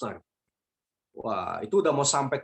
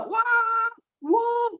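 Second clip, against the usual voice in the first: high-pitched mock wail; frightened screaming; 3 dB louder than usual. The other passengers in turbulence, mock screaming. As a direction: imitate terrified passengers. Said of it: "Kok yang lain pada".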